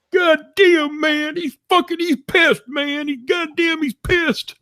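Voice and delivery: very high, frantic squeal; hammed-up panic; panicked squeal. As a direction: wail that he's in trouble. Tim panicking about angry O'Hare.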